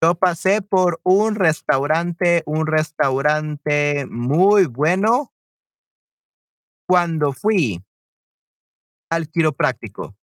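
slow, phrase by phrase, with pauses; slow teaching pace. Shown as a demonstration. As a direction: draw out the sentence for learners. Sentence built slowly for students.

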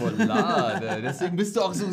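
laughing echo. Real, not acted. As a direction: savour the word while laughing. Co-host echoes it, laughing.

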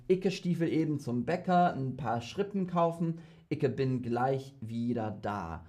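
German in a Berlin dialect; a light Berlin German accent demo. Shown as a demonstration. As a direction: Berliner Schnauze, lightly done. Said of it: "in a Berlin dialect".

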